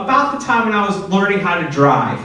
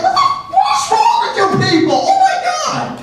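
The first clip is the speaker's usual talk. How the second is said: much higher, fast, laughing hammed-up yell; exasperated shout; 4 dB louder, faster than usual. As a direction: blow up in mock horror at the nurses. Retold outburst at pee sample.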